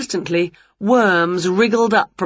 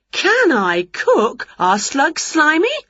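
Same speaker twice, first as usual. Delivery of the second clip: slow boastful witch; 9 semitones higher, slower than usual. Acted. Winnie boasts about cooking.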